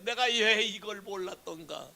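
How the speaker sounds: soft, mock-weeping regret; mock tearful lament. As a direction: imitate someone wailing in regret, but hushed. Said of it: "울고 불고 난리야". (흉내 낸 울먹이는 후회)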